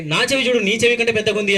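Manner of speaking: high-pitched acted boast; boastful challenge. Brother showing off a fake ear to the demoness, boastful.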